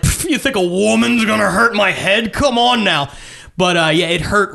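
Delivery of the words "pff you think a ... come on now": higher, scoffing mock bravado; imitated tough-guy dismissal, pitched up; put-on bravado. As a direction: scoff as if it didn't hurt at all. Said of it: "on the outside, I was like".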